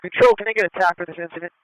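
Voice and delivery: police radio with crackling bursts; like a squad car radio call; radio static bursts. Real, not acted.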